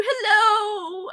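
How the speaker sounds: high, squeaky cooing hello; cute high hello to a viewer; cutesy hello. Pretend. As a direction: coo hello at a friend. Greeting a viewer cutely.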